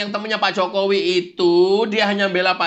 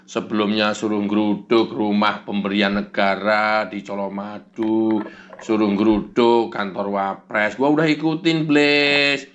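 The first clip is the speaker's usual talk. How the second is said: much lower, slow, glum complaining; sulky low Kurnia; 10 semitones lower than usual. Acted. Kurnia grumbles to the devil about being abandoned. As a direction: grumble like a sulking lackey. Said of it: "kenapa lu cemberut aja".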